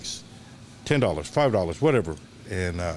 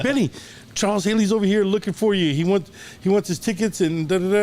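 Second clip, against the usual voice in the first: high, loud, frantic calling across a crowd; excited calling out; 10 semitones higher, 4 dB louder than usual. Retold or imitated. Nervous fan calling for his scalper friend, high and loud. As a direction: yell for your buddy in a giddy rush.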